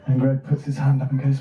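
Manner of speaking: normal talking voice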